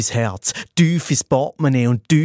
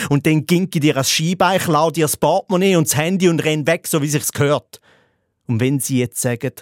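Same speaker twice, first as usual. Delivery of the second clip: ordinary delivery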